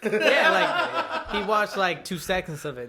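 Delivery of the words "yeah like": loud high laugh into words. Real, not acted. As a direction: laugh through it. Laughing burst.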